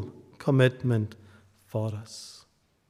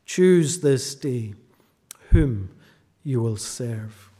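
slow solemn charge; 3 semitones lower, slower than usual. Acted. Closing repeat of the charge.

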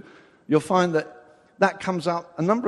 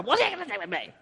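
higher, gentle, not hostile; gentle version; 6 semitones higher, much faster than usual. Shown as a demonstration. Jesus speaking to his mother, gentle and kind. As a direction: say it kindly, the way a son would. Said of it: "I don't think he said to his mother".

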